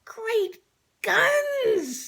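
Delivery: high, loud exclamation; startled cat. A narrator's reading. Jinx reacting to the news, high startled cry. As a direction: blurt it out in shock. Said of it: "said Jinx".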